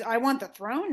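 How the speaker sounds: questioning, lifted on THRONE; incredulous echo. Retold or imitated. Creon repeats the accusation back to Oedipus, incredulous. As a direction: throw the charge back as a disbelieving question. Creon echoes the charge, then denies it.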